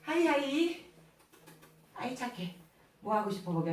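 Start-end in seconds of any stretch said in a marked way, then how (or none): 1.9-3.7 soft praise. Real, not acted. Mother praising small child.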